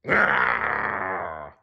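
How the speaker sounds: short rough growl; dog growl. Acted. The dog growls chasing Blinky. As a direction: growl as you chase. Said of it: "growled the dog".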